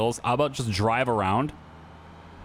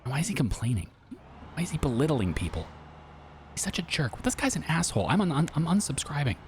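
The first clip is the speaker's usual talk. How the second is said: annoyed viewer; 3 semitones higher, 4 dB quieter than usual. Retold or imitated. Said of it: "some people will be like".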